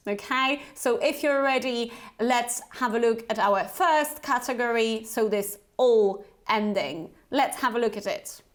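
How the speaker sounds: ordinary talk, nothing marked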